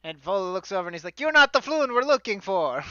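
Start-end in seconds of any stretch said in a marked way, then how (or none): 1.2-2.8 loud, high fake Italian accent; hammed-up Italian accent, raised voice. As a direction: call it out in bad fantasy Italian. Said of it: "bad, fake fantasy Italian accent".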